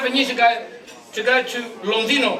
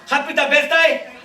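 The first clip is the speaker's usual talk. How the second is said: usual speaking voice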